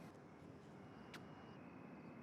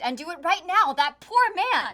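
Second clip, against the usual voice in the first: louder, rising angry shout; shouting angrily; 6 semitones higher, 10 dB louder than usual. Acted. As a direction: yell it at him, furious. Daughter shouts at her father.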